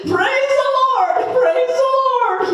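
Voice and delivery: high, loud exultant cry; joyful praise. Acted. Old man rejoicing that he survived, high exultant praise. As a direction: shout your thanks to heaven.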